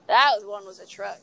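ordinary talk

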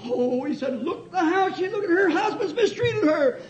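high, wailing courtroom plea; mock tearful pleading. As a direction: ham up a lawyer's weepy appeal. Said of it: "I cried a little".

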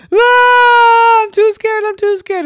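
loud, high terrified yell; scared shriek. Acted. Jenny at the neighbor's sidewalk, shrieking in fright. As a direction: shriek and bolt.

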